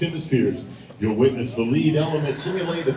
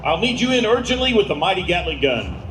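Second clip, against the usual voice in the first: urgent, higher radio calls; military radio controller; 6 semitones higher than usual. Acted. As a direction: bark out the grid like a JTAC. Announcer voices ground controller.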